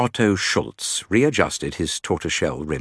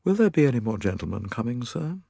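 quick, deferential servant's question; respectful butler voice, rising on "sir"; deferential manservant; 6 semitones higher, faster than usual. A narrator's reading. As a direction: ask politely, as a valet to his master. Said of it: "in a low, deferential voice".

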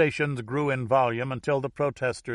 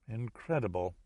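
hushed English amazement; breath suspended; 7 dB quieter than usual. A narrator's reading. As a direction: say it softly, breath held. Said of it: "his breath suspended".

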